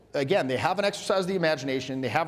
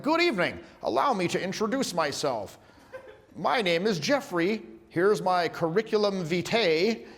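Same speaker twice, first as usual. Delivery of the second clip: formal introduction; 3 semitones higher than usual. Acted. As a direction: announce yourself with overdone courtesy. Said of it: "if you said it like that".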